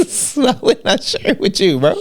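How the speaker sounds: high, playful put-on voice teasing his friend; mock lover voice. One friend teasing another in a mock lover's voice. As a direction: tease him like a coy lover.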